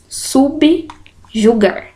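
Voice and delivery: Subjugar split slowly into syllables, su-bju-gar. Shown as a demonstration. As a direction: draw out Subjugar with clear gaps between syllables. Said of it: "É assim que seria feita a separação silábica".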